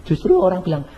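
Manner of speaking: normal talking voice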